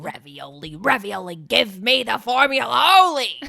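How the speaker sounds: villain chant building to a loud demand; Willem Dafoe. Retold or imitated. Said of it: "Willem Dafoe is trying to steal your secret formula".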